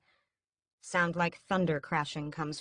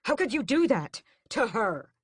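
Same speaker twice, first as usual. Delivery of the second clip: yelled; 6 semitones higher, 5 dB louder than usual. A narrator's reading. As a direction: yell it at his mother. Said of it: "I yell at her".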